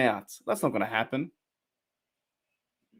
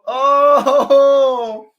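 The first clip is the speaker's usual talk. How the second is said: high, loud drawn-out cry of "oh"; loud excited "oh"; 12 semitones higher, 8 dB louder than usual. Real, not acted. Fan reacting to the game, loud excited cry. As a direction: let out a long, high fan's whoop.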